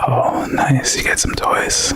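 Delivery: mock-excited whisper; ASMR whisper. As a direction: gasp then whisper excitedly. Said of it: "in A S M R voices".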